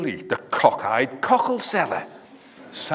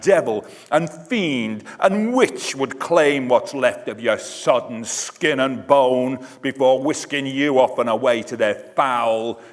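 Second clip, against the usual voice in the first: ordinary delivery, nothing marked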